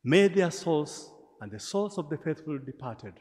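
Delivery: ordinary talk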